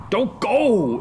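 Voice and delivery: high, urgent plea; imploring. Acted. Friends holding Hamlet back from the ghost, imploring. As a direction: beg him to stay. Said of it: "implored his friends".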